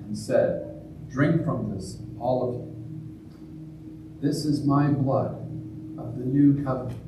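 solemn intoned eucharistic prayer; liturgical prayer. Acted. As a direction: pray it aloud with reverent weight.

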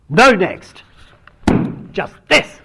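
high, loud burst, cutting in; blurting interruption. Retold or imitated. Zen teacher cutting off the story, loud high exclamation. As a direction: blurt it out, unable to hold back. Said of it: "Hogan just couldn't restrain himself".

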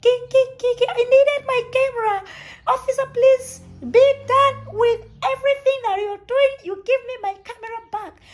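high, loud desperate begging to the officer; pleading inner voice. Retold or imitated. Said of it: "whatever was clicking in my mind".

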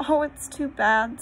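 a touch of tearful protest; mild sob. A narrator's reading. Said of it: "sobbed Lucy".

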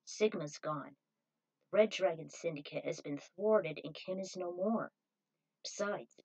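a confident hero explaining why he stays; Zero's voice. Acted. Zero voiced lower.

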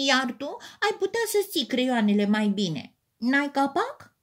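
a light, storybook snap; snapping. A narrator's reading. Teddy bear snaps at the crayon box, mild anger. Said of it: "se răsti".

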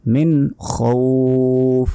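মিন খাউফ slowly, "khoouf" held; rasped kh, long vowel in খাউফ; stretched ending. Shown as a demonstration. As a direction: recite মিন খাউফ, drawing out the end. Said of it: "শেষে টান".

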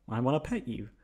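ordinary talk, nothing marked

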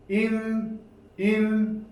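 "İn" said twice, short i closed on n; reading demo. Shown as a demonstration. Teacher to class, demonstrating "in". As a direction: model "İn, in" crisply. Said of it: "Esre ile beraber vuruyorsunuz".

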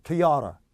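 토요타 as "tuh-YAA-ra"; American pronunciation. Retold or imitated. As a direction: punch the middle of 토요타, weaken both ends. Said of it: "미국 사람들이 도요타를 어떻게 발음하냐면".

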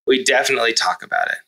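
ordinary delivery

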